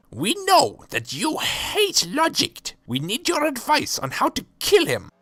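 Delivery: a little villain plotting a murder; squeaky villain voice. Acted. Asking the oracle how to kill Logic, high scheming squeak.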